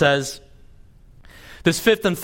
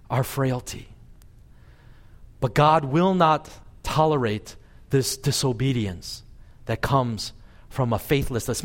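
normal talking voice

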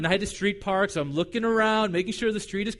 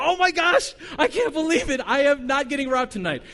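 high, loud laughing disbelief; a loud, laughing burst of relief after a scare; 6 semitones higher, 4 dB louder than usual. Retold or imitated. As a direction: gush with giddy relief. Relief after not being robbed.

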